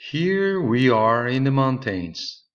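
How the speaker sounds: excited tourist voice; Marie's voice. Acted. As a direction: call it out like arriving somewhere grand. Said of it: "Marie says".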